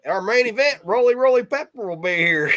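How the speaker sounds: high, loud hammed-up announcer voice; hammed-up high announcer introducing the main event; mock ring announcer.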